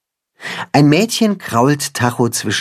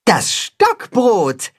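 higher, very slow, drawn out; calling out; 10 semitones higher, much slower than usual. Acted. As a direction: announce it grandly. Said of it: "ruft das Katzenhuhn".

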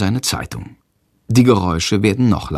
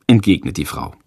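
ordinary delivery

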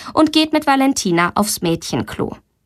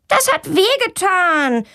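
scolding back; 9 semitones higher, slower than usual. A narrator's reading. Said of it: "schimpft Valentina zurück".

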